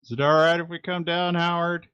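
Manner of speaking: high, quick question called downward; calling out. Acted. Shane asking Howard to come down, high call. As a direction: call down to ask permission.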